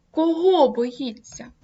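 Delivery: «Кого» голосно і вище, повільно; вдар голосом по «Кого»; stress on Кого. Shown as a demonstration. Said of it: "вирізняючи логічним наголосом". (логічний наголос на «Кого»)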